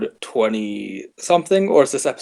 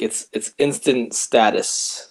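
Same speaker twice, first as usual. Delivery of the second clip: usual speaking voice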